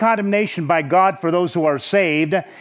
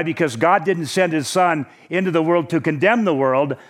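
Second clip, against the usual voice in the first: ordinary delivery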